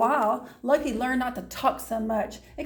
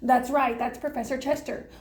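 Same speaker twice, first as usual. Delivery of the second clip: ordinary delivery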